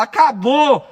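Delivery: shouting Acabou with fury. Shown as a demonstration. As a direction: yell that it is over. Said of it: "Pode gritar".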